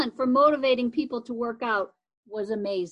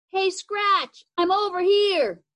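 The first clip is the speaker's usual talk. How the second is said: calling out louder down the street; 7 semitones higher, 5 dB louder, slower than usual. Acted. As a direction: call to a friend past the house.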